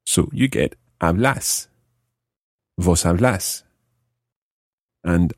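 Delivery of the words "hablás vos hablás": hablas and hablás both as ab-LÁS; stress on final vowel. Shown as a demonstration. Teacher building the vos form, hablás stressed on final vowel. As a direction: land "hablás" on its last syllable. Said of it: "put an accent on the final vowel".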